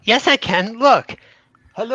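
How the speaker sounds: high, loud holler; defiant yelling. Acted. Adventurer defying warning in the house, high yell. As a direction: yell it out to prove you can. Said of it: "You can't yell like that in here".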